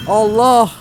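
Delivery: sudden loud high cry; real fright shout; startled yelp. Real, not acted. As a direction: jump at the scare. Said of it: "Arka arkaya korktum".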